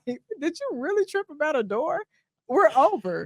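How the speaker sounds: host dumping an imagined partner over a door; mock exasperated dump. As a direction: scoff and end it on the spot. Acting out her breakup response.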